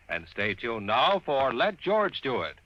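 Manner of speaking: ordinary talk, nothing marked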